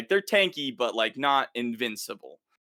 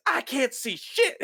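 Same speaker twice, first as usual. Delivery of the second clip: high, slow, laughing knight blind in the dark; high slow laughing soldier voice; panicked knight; 12 semitones higher than usual. Retold or imitated. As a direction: play a scared knight who can't see, half laughing. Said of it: "And one of the knights".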